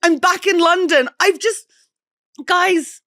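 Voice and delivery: high excited welcome back; high, eager homecoming greeting. Real, not acted. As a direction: greet them bursting with excitement.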